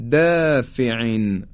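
دافعین with thin fronted "dæ", drawn out; slow recitation of دافعین, keep دا light; light tarqiq. Shown as a demonstration. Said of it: "ترقیق و نازک ادا شد".

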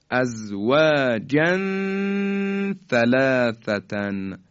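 slow recitation of «ازواجن ثلاثتن», hummed tanwin; measured «ازواجن ثلاثتن» with a long nasal link; ikhfa. Shown as a demonstration. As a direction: stretch the last n of «ازواجن» into «ثلاثتن». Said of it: "این طرز اخفای".

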